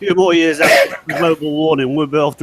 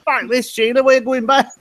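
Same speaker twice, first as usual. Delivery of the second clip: put-on Australian accent; 8 semitones higher than usual. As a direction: ham up an Aussie wife giving up. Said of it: "That was a very good Australian accent".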